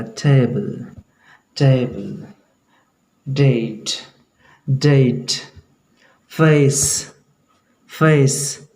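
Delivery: टेबल, डेट, फेस with a gliding "ay" vowel; British long A. Shown as a demonstration. Teacher drills words with British A, stretched gliding vowel. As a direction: stretch the ए in each word into ay. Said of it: "तो ऐसा जब उच्चारण होता है ए".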